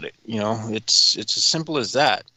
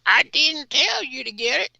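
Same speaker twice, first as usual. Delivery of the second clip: very high, mocking politician voice; Graham impression. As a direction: plead in a parody senator's falsetto. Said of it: "Graham tried again".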